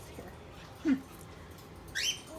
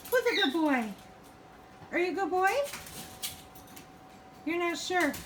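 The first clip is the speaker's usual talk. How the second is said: high cooing pet voice; 5 semitones higher, faster than usual. Real, not acted. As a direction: sweet-talk a little bird.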